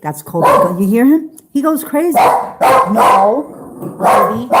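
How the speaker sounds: sharp, loud commands to a barking dog; scolding the dog. Real, not acted. Owner scolding her barking dog Kobe, loud and sharp. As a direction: raise the voice to stop the dog barking.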